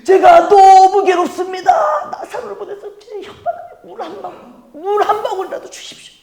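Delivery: high, strained, laughing pleading wail; desperate rich man. Acted. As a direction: beg as a man in torment. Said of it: "이 부자는 어떻게". (불 속에서 고통받는 부자가 높고 절박하게 애원)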